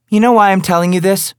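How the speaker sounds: high, loud, needling female voice; mocking woman. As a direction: goad him with it.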